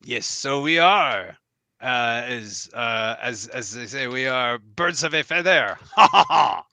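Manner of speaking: put-on hesitant French-accented mouse knight, ending in a staged laugh; grand French-tinged accent with a mock hearty "ha, ha, ha"; French accent, groping for the saying; French lilt. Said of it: "a little bit of a French lilt".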